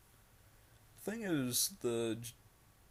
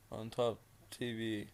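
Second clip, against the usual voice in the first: ordinary delivery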